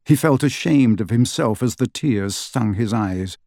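ordinary delivery

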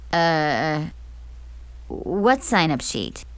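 slow, puzzled question; confused. Acted. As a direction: sound lost and puzzled. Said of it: "Zoe asked, looking confused".

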